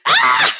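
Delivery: very high, loud fan scream; short hammed-up shriek of excitement; excited scream. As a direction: let out a piercing mock scream. Acting out own fan reaction.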